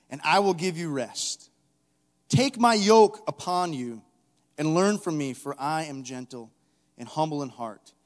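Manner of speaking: measured, slow scripture read to the congregation. Acted. Preacher reading Jesus' words to the church, slow and weighty. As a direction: let each phrase of the verse land.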